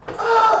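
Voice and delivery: very high loud shriek; startled scream. Retold or imitated. Friend imitating the woken cameraman, startled scream. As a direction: scream awake in terror. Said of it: "And Jim goes".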